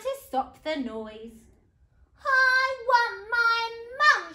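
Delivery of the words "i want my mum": loud, high, slow shriek; sing-out storybook shriek; shrieking princess. A narrator's reading. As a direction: shriek it word by word like a child. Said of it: "shrieked the little princess".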